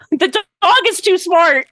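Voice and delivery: high, loud, giggly mock alarm; laughing protest. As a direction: protest through helpless laughter. Player laughing at own character's suspicion.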